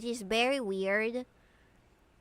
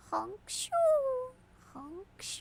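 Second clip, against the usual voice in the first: snoring; 12 semitones higher than usual. Pretend. Mock snoring noises.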